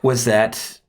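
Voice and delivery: normal talking voice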